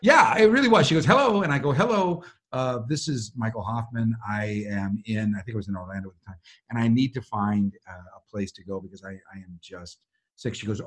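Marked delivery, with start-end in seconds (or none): 1.1-1.4 bright high lilting hello, imitating her; joyful phone greeting. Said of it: "I could sense her joy over the phone". 1.7-10.6 hello sags into a low, soft voice; retold sick caller. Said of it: "I'm really, really sick".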